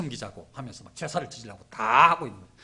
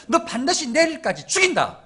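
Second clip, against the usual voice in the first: loud, high-pitched death threat; Jezebel's threat; 9 semitones higher, 13 dB louder than usual. Acted. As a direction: deliver a forceful royal ultimatum, building louder. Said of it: "이렇게 말한 거죠".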